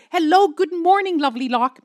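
chirpy morning call to the desk; cheery greeting. Retold or imitated. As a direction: beam down the phone to London. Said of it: "I called them Lovely Locke".

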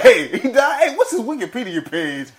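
high, loud, laughing mimicry; mock his eager questioning through laughter; laughing, exaggerated quote of a friend; mocking imitation of cohost. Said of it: "You're like".

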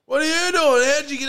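high, loud, indignant yelling back; retold yell. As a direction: yell it back like he owns the place. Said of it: "He goes".

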